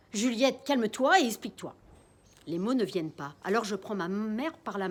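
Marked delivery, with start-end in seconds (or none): 0.1-1.7 loud, firm order; firm command. Acted. Father sternly ordering Juliette to calm down. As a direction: tell her firmly to calm down.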